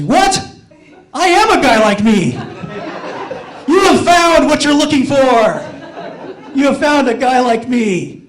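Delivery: loud, high, exasperated mock shout; hammed-up pleading yell, words pounded out; inner outburst. Suitor silently begging the girl to notice him, exasperated shout. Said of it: "In my mind, I'm actually".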